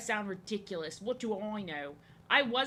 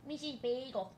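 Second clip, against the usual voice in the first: higher cooing "Missus Bagel"; pet talk; 4 semitones higher than usual. Real, not acted. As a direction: coo the cat's name fondly. Cooing to her cat.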